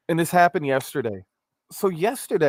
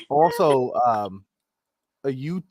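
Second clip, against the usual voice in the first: usual speaking voice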